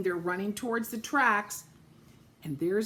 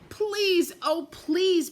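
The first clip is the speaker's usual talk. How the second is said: pleading cry; 9 semitones higher, 5 dB louder, slower than usual. A narrator's reading. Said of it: "cried all the dolls and toys together".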